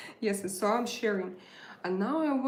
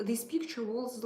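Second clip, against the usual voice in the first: ordinary delivery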